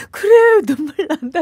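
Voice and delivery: high, loud, tearful cry. Retold or imitated. Reader crying over the child's story, high and tearful. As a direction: cry out these words while choking up. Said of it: "이러면서 막 울었는데요".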